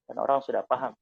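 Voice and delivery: usual speaking voice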